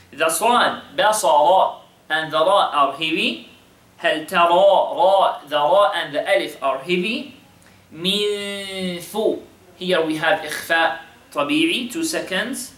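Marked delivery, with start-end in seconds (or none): none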